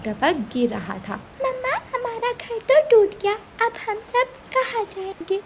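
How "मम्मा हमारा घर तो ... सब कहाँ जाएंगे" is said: put-on high, small worried child voice. Chick asking mother where they will go, worried child. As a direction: ask like a lost little kid.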